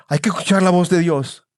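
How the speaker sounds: ordinary delivery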